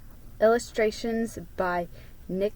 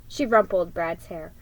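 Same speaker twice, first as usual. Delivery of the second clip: ordinary delivery, nothing marked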